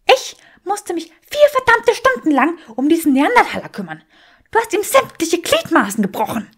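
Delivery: furious witch snapping back; angry Granger. Acted. Granger fires back in argument.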